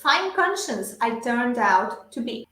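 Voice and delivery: slow, carefully paced English model sentence; measured language-teacher read-aloud; shadowing model reading. Shown as a demonstration. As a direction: read it slowly for learners to repeat. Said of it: "yo leo las frases y ustedes repiten".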